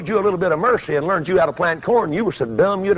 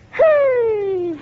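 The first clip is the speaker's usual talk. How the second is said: very high, drawn-out whoop; shouting worshipper. Retold or imitated. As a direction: holler it high like a revival shouter. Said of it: "he shouted his way to the seat".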